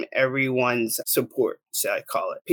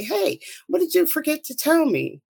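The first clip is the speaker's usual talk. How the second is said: high-pitched, eager phone pickup; bright phone greeting; 12 semitones higher than usual. Retold or imitated. Answering the pastor's expected call, high-pitched greeting.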